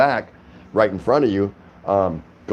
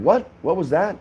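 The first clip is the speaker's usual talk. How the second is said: retold startled question; 9 semitones higher than usual. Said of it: "I said".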